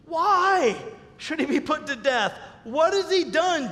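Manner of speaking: high, loud, slowing on what has he done; rising indignant challenge; pleading protest. A narrator's reading. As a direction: push back hard at the father. Jonathan confronts his angry father.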